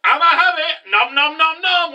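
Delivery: high, playful munching voice; greedy gobbling. Retold or imitated. Ignis biting his arm off, greedy gobbling. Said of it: "Ignis was just like".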